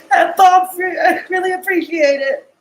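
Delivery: crying. Real, not acted. Thanking Bob on Discord for his support, crying. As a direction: thank him through real tears. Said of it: "Sorry I'm crying".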